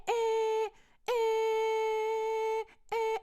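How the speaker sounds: high, slow honking noises; car horn imitation.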